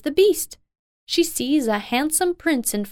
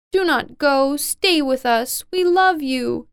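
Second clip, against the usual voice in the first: lightly acted plea, higher pitched; tearful pleading; 4 semitones higher than usual. A narrator's reading. Sisters begging Beauty not to leave, mildly tearful pleading. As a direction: beg them to stay, with a touch of tears. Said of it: "her sisters begin to cry".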